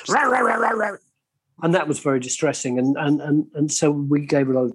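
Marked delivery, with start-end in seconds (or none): 0.1-1.0 mock growl. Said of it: "pretend bite".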